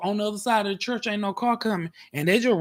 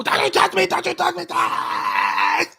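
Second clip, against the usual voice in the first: mock hurt shout; 10 semitones lower, 4 dB louder than usual. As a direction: shriek it like a fake victim. Pretending to fend people off.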